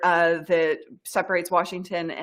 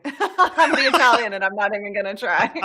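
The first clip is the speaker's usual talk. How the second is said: laughing. Real, not acted. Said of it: "I'm not even trying".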